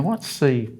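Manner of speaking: ordinary talk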